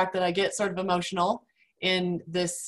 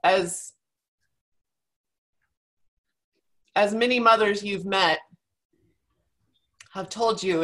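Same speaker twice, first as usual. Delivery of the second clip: holding back tears. Real, not acted. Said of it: "I'm trying not to cry".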